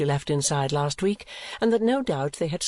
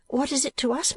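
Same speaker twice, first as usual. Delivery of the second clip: higher, mildly challenging question; 11 semitones higher than usual. A narrator's reading. Daughter reasoning with mamma, questioning. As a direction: lift "What is it to us" as a question.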